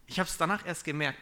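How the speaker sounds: ordinary delivery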